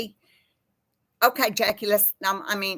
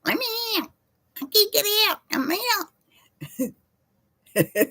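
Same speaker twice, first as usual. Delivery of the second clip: high squeaky parrot pleading, much higher; 12 semitones higher than usual. Retold or imitated. Repeating the parrot story for Kathy, high parrot impression. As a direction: squawk it like the caged parrot. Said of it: "retells parrot for Kathy".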